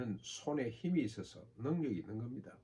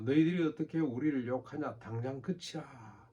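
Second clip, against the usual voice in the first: loud, higher-pitched angry protest, slowing at the end; indignant outcry; 4 semitones higher, 6 dB louder than usual. Acted. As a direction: cry out to silence the prophet. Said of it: "외치고 또 조롱하고". (분개하여 크게 외치는 목소리)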